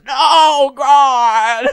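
loud, high anguished wail; hammed-up film-star cry of despair; Jimmy Stewart. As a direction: stretch each word into a melodramatic howl. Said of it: "And he goes".

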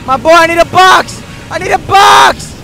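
high, loud plea; desperate shout. Real, not acted. Gamer desperate for a box, urgent shout. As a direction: yell urgently for help.

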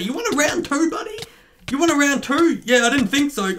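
high, cocky taunt. Real, not acted. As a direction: goad him. Taunting a rival.

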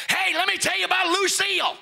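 high, hollered exposé; public announcement shout. Acted. Preacher mimicking God exposing someone publicly, shouting. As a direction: holler it for everyone to hear. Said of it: "Aren't you glad he doesn't say".

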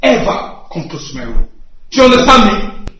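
loud, furious orders to leave; angry order. Acted. Son shouting at the unwanted bride, furious. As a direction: drive her out of your room.

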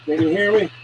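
loud, high hail; calling out. Real, not acted. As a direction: call out to someone far off over the radio. Hailing a distant radio listener.

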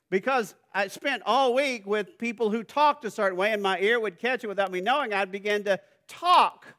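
loud, high, ringing preacher's voice; building sermon delivery, big and high; full-force preaching. Acted. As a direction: preach it out to the congregation. Preaching about talk and habits.

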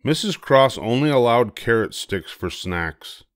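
ordinary delivery

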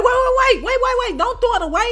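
high hurried wait wait; frantic plea. Retold or imitated. A girl begging her not to throw food out, high frantic plea. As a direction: rush to stop her.

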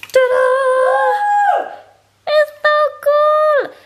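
high, loud, slow ta-da; thrilled unveiling, way up high; excited reveal. Real, not acted. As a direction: show it off with a big squeal. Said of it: "unveiling the book cover".

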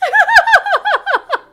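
loud high squeal of laughter; shrieking laugh. Real, not acted. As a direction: burst out laughing. Laughing after bird voice.

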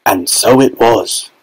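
ordinary delivery

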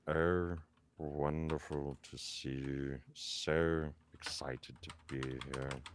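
low, slow and quiet, savouring each word with menace; Alan Rickman impression. Shown as a demonstration. Demonstrating his Snape-style voice for a role, low slow drawl. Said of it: "that Alan Rickman sort of".

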